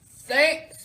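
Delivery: loud, lightly voiced monster; booming monster. A narrator's reading. Monster answering Captain Pete, a booming touch. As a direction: boom it out in one burst. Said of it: "booms the monster".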